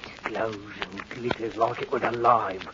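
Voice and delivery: low, hushed awe; eerie fascination. Acted. Henry gazing at the knife, hushed and fascinated. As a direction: speak softly, as if under a spell.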